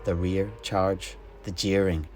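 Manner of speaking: plain reading voice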